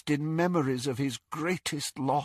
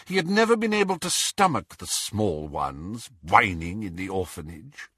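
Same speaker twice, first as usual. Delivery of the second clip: plain reading voice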